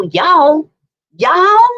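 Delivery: exaggerated American drawl, rising high; hammed-up American accent, messing around. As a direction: put on a loud, high American twang. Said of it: "in American accent".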